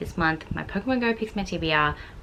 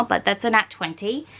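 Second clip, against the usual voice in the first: ordinary delivery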